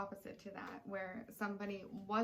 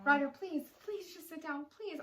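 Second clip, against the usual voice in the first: retold begging; 7 semitones higher than usual. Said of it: "I'm begging with him".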